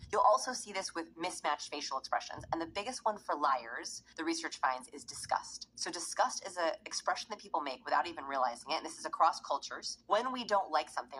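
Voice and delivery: ordinary talk, nothing marked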